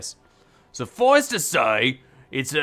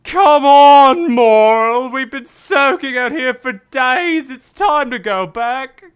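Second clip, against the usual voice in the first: high, louder pleading wife; 10 semitones higher, 10 dB louder, slower than usual. Acted. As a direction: coax him home, pleading and high. Voices the wife Lena.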